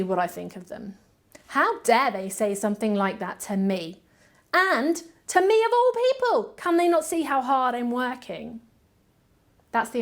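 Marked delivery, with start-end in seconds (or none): 1.5-8.6 rising, louder mock outrage; hammed-up wounded pride, peaking high; mock indignant rant. Administrator acting out her angry reply to the critic, mock indignant. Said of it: "my emotional response and the emotional response".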